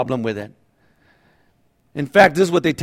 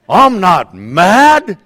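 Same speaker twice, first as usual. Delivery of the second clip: slow, louder, laughing fake calm; denying anger; 3 semitones higher, 8 dB louder, much slower than usual. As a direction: insist you're not mad through a laugh. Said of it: "you tell your spouse".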